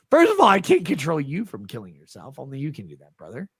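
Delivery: ordinary delivery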